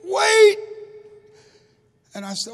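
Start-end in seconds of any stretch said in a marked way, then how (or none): 0.0-0.6 desperate high scream of wait; a mother screaming after the car. Retold or imitated. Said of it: "Then I heard a scream".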